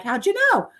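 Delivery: high surprised question; a high squeal of mock surprise. Imagined friend's surprised question.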